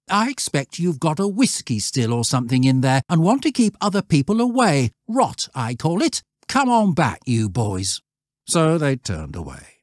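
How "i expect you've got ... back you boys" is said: higher, sneering schoolboy; cheeky boy. A narrator's reading. Stanley mocking the shepherd to his friends, higher and sneering. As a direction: sneer it like a know-all boy.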